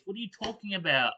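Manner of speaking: normal talking voice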